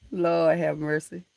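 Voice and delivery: ordinary delivery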